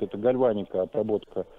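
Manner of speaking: ordinary talk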